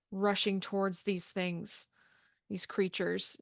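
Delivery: ordinary delivery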